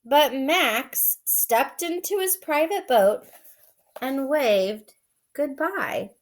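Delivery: story reading. A narrator's reading. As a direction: read it like a bedtime story. Reads picture book aloud.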